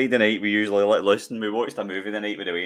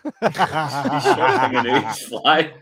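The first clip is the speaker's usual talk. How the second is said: laughing; 3 semitones higher, 6 dB louder, much slower than usual. Real, not acted. Laughing at the film.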